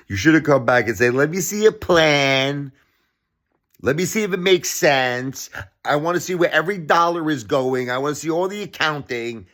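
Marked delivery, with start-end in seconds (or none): 1.1-9.5 higher-pitched mocking imitation; sarcastic quote. Quoting the billionaire's reply to world hunger appeal, sarcastic. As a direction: mimic a sarcastic demand, pitched up. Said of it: "with a sarcastic statement".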